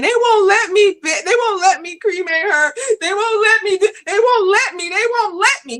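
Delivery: high, breaking voice, crying out that they won't let her; re-live the sob as you plead; tearful crying plea. Retold or imitated. Mother crying to her pastor about her daughter's ashes, high and tearful. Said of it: "as I'm crying to him".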